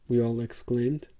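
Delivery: ordinary delivery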